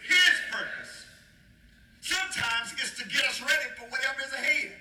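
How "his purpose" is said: high, emphatic call on HIS; louder emphasis. Shown as a demonstration. Preacher urging the congregation to say it louder, emphatic call. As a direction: punch it out higher and louder. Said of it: "say it louder".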